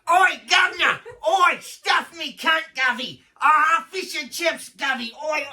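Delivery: loud, high caricature British yelling; mock British accent. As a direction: bellow it in a crude British accent, stretched out. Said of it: "this is what I got".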